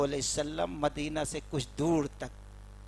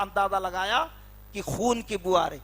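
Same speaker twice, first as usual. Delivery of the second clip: usual speaking voice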